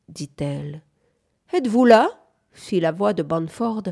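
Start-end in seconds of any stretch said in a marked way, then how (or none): 1.5-2.1 high, loud call from indoors. A narrator's reading. Bunford calling the pair from the house, calling out. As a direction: project the question out to someone outside. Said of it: "appelant de la maison".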